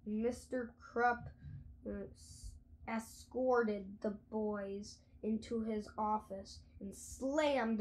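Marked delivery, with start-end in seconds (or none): none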